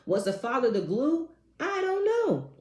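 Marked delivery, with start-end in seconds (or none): 1.6-2.5 high exasperated I don't know. Real, not acted. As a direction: throw up your hands, high.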